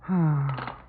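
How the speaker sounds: long tired sigh; gloomy exhale; weary sigh. Acted. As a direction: let the breath sag out in defeat. Weary sigh after call.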